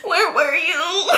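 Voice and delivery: high, slow mock sob; crying. Girl finding her cousin after the shooting, acted crying wail. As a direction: wail it out, hammed-up tears. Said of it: "I'm crying".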